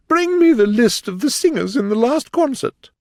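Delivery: high, loud, imperious queen. Acted. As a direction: bark an order like a haughty queen. The Queen commanding.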